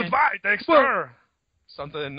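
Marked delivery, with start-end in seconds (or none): 0.0-1.1 loud, high, drawn-out farewell; cartoon Dexter voice. Host doing Dexters' goodbyes on request, loud high cartoon voice. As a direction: belt out a slow cartoon goodbye. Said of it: "all the Dexters saying goodbye to each other".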